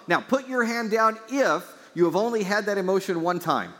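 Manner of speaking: high, loud rousing preacher call; preaching at full force. Acted. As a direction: stir the room to raise hands.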